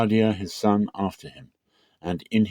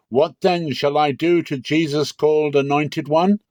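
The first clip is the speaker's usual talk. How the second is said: Pilate's question; 8 semitones higher, 4 dB louder than usual. A narrator's reading.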